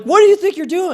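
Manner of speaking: loud, high indignant challenge; pissed off. Retold or imitated. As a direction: confront the man hauling you out. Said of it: "I'm, like, pissed off".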